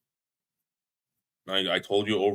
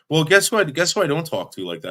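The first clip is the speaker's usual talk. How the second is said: louder and higher, indignant; heated; 7 semitones higher, 5 dB louder than usual. Real, not acted. Arguing on a call with a viewer, heated. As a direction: snap back, exasperated.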